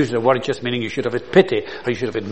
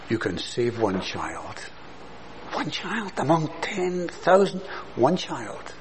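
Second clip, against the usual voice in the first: tender hushed; 2 semitones higher, 9 dB quieter than usual. Real, not acted. Tender answer to despair.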